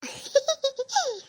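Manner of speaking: high-pitched gleeful giggling; sly triumphant laugh; gloating giggle. Acted. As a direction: giggle at having fooled them. Gloating after fooling mother-in-law.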